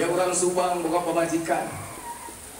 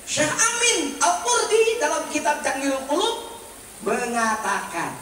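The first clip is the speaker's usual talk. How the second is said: high, raised oratorical delivery; forceful preaching; 9 semitones higher, 3 dB louder than usual. Real, not acted. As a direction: drive the teaching home to the congregation. Preaches doctrine at full force. (ceramah berapi-api dengan nada tinggi)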